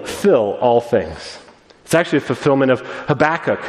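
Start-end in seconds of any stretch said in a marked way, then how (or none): 0.1-1.4 "fill all things" spoken low and very slowly; a lingering, low "fill all things"; slow emphasis. Shown as a demonstration.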